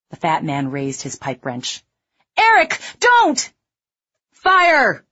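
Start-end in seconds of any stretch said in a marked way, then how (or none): 2.4-3.5 very high, loud slowed scream; woman screaming in panic; terrified scream. A narrator's reading. 4.3-5.0 loud, high command; lieutenant shouting fire to the squad; barked order. A narrator's reading.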